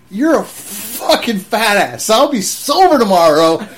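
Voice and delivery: put-on loud, slow drunken taunt; drunken insult. Drunk airman insulting the sergeant to his face, loud slow taunt. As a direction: spit the insult back at him.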